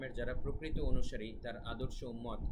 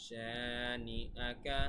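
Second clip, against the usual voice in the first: শানিআকা very slow, first শা lengthened; one-alif stretch; much slower than usual. Shown as a demonstration. Teacher shows students the one-alif stretch, long শা in শানিআকা. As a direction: draw out the শা of শানিআকা. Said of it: "এখানে এক আলিফ টান".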